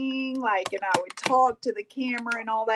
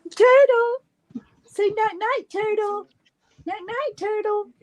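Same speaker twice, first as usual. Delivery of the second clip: high sing-song coo; 11 semitones higher than usual. Real, not acted. Cooing goodnight to baby.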